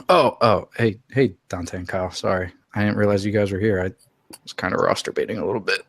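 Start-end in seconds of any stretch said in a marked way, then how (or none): none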